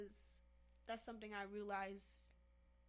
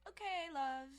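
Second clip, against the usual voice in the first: bad British accent; 7 semitones higher, 8 dB louder, slower than usual. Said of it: "how bad your British accent is".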